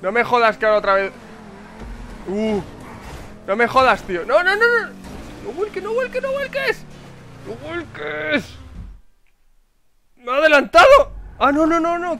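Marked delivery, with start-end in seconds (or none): 4.3-8.6 very high, pleading don't flip; panicked pleading. Real, not acted. Gamer begging his car not to flip, panicked pleading. 10.2-12.2 high, loud dismay; alarmed shout. Real, not acted. Gamer overtaken by a rival car, alarmed shout.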